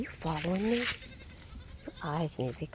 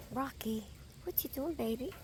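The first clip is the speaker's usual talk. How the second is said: soft pitched-up pet talk; cooing at a goat; 5 semitones higher, 8 dB quieter than usual. Real, not acted.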